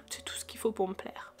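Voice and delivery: soft whispered aside; whispering. Real, not acted. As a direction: whisper it like a secret.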